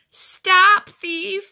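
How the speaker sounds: high, loud bellow; full-voiced alarmed shout. A narrator's reading. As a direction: bellow after a thief. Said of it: "bellowed Mister Grumby".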